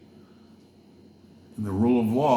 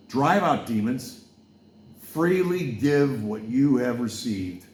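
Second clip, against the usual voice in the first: slow, louder preacher cadence quoting scripture; preaching; 3 semitones higher, 3 dB louder, slower than usual. Acted. Preacher quoting Jesus to the crowd, slow and emphatic. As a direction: deliver it like a sermon from the pulpit. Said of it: "I was gonna preach about".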